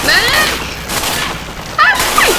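high desperate yell for help; man down shout. Real, not acted. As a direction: scream for a medic. Injured, calling for a medic.

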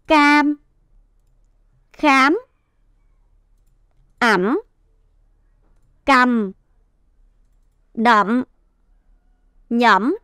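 isolated words, each held with its full tone; smooth whole-word reading. Shown as a demonstration. Teacher rereads the syllables for the class, whole and measured. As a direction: read each word whole, pausing well between. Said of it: "chúng ta sẽ đọc trơn lại".

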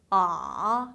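아 sinking low, springing up at the end; third tone. Shown as a demonstration. Teacher showing third tone, 아 low then bouncing. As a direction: dip 아 like a spring, short lift. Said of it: "스프링처럼 튕겨나가는 느낌이".

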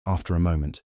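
ordinary delivery, nothing marked

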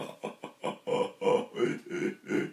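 quick hushed panting pulses; interrupted Mueller maneuver. Shown as a demonstration. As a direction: pant softly in short, broken breaths. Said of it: "in an interrupted version".

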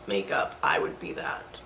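hushed deadpan line; whispered joke. Real, not acted. Joking she's a vampire, whispered. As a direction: murmur it as a joke.

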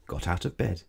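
plain reading voice

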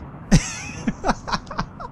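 high-pitched breathy giggling fit; high giggle. Real, not acted. Gamer cracking up at a colonist called Squirt, high giggle. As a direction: crack up at the silly name.

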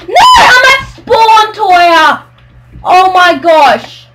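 loud, high angry yell; real gamer rage; frustrated shout. Real, not acted. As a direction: shout your outrage at a teammate. Killed, yelling at friend.

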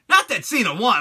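usual speaking voice

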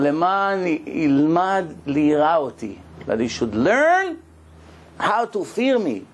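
slow, deliberate chanting of the verse; slow scripture recital. Acted. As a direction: read it out slowly like from the scroll. Reciting Hebrew verse then translating.

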